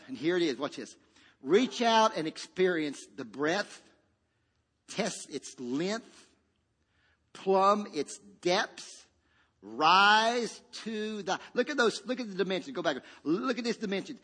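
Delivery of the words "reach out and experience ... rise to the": stretched-out, deliberate scripture read; slow scripture reading. Acted. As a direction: savour each dimension slowly.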